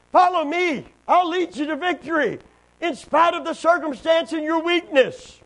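loud, high impassioned preaching; fiery preacher's proclamation; preaching at full force. Acted. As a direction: lift it up and proclaim it to the church. Preacher voicing Christ's promise.

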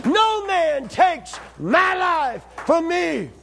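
ringing proclamation; preached shout. Acted. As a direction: stretch every word like a cry from the pulpit. Preacher's climactic repeated cry.

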